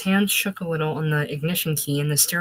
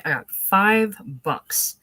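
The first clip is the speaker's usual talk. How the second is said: drawn-out mock shout, FIVE stretched and raised; narrated shout; 4 semitones higher, 4 dB louder, much slower than usual. A narrator's reading. Magnum man bragging over a fishing story, mildly shouted and drawn out. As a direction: half-shout it slowly, leaning on FIVE. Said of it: "was shouting".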